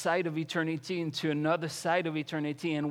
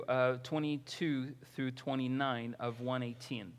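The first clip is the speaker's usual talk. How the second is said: usual speaking voice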